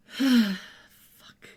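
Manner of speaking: heavy sigh into a muttered curse; exasperated sigh acted out; resigned sigh. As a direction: imitate a long, defeated sigh, then swear. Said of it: "Leo just sighs".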